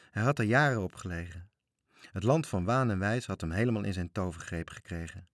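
plain reading voice

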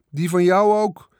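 high and loud yell; shrieked. A narrator's reading. Boy on the swing shrieking back at his sister, loud. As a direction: shriek it back across the barn. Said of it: "gilde Michiel".

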